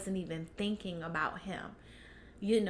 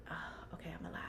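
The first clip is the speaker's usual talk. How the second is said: whisper; 3 semitones lower, 14 dB quieter, much faster than usual. Retold or imitated. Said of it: "I was just like".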